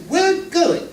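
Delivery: high bossy falsetto wife. Acted. Wife overrules husband about the nightclub, high falsetto. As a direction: state it as the wife who won't budge.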